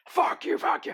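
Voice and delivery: snapped high and sharp; retold angry curse. Guard cursing the old inmate before walking away, sharp high retort. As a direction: fling it back at him, stung.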